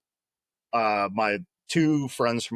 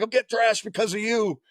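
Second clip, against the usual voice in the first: much higher strained yell; angry mock yell; 11 semitones higher than usual. Buddy yelling at Bringard on the quarterdeck, angry mock yell. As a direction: blame him, shouting.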